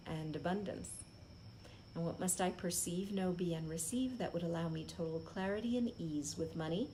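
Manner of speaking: soft, steady chant of the question; repeated affirmation. Acted. Speaker repeating her money question, soft chanted affirmation. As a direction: recite it over and over like a mantra.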